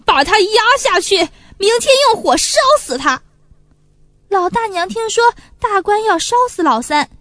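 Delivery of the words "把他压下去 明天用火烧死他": official screaming orders in a fury; raging yell. A narrator's reading. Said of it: "咧着大嘴哇哇乱叫". (哇哇乱叫)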